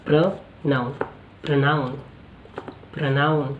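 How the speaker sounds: प्रोनाउन said with a short "pra", not "pro"; प्रोनाउन with the first vowel cut to "pra"; pronunciation demo. Shown as a demonstration. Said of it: "इसको हम प्रो नहीं प्र पढ़ते हैं".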